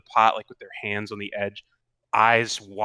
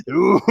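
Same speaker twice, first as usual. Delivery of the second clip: spooky ooh; 9 semitones higher, 11 dB louder than usual. Acted. Narrating cauldron burst.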